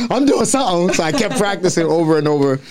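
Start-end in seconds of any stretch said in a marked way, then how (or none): 0.1-0.9 high, mock-proud declaration; determined younger self. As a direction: younger him swearing to keep going. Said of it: "I was like".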